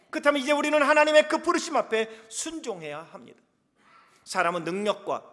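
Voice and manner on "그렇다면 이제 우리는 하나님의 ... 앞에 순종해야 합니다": 열정적 설교. Acted. 힘차게 설교하며 축복을 선포.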